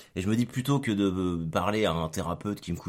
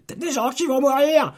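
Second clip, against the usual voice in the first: mock dramatic; 10 dB louder than usual.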